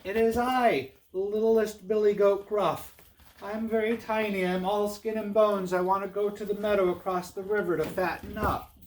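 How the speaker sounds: high, tiny little-goat voice; small high-pitched storybook kid goat. Acted. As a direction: speak up as the littlest, meekest goat. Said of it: "in a very small voice".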